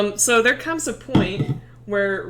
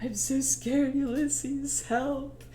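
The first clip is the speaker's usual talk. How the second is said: frightened dying plea; 3 semitones higher, 4 dB quieter, slower than usual. Acted. Dying Ricky in Ulysses' arms, softly scared. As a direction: act fading away, begging for help.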